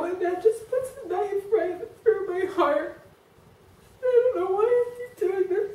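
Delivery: high, tearful voice breaking through a laugh; sobbing it out, voice cracking high; real crying. Real, not acted.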